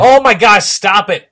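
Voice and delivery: excited. Real, not acted. Excited reaction to nervous cohost.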